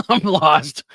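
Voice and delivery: laughing through confusion. Real, not acted. Host baffled by a chat message, laughing.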